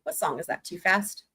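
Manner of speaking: ordinary talk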